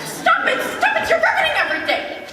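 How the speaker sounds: very high, quick comic tantrum; shrieky protest. Acted. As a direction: squeal over him in hammed-up outrage.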